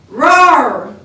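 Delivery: a big loud bear's roar. Acted. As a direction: roar like a hungry bear. Bear roars at oven.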